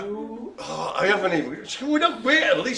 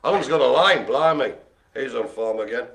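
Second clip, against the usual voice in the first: ordinary talk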